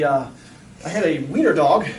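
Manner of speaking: normal talking voice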